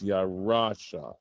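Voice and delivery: Yarasha with the weight on RA; accent on middle syllable. Shown as a demonstration. Teacher showing students the accent, Yarasha stressed on RA. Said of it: "that's where the accent falls".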